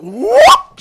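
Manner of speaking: very high, loud yelped whoop; imitated whoop. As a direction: mimic the startled guy leaping up. Guy springs up, imitated noise.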